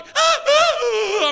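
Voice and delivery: high, loud frightened yelps; startled shrieks. Retold or imitated. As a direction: squeal like a startled wife. Wife startled again.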